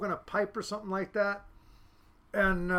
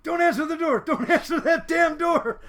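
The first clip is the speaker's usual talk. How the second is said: loud, high, laughing shout; high-pitched frantic order through laughter; retold panicked warning; 7 semitones higher, 7 dB louder than usual. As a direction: yell it in mock panic, cracking up. Retold panic, cops at door.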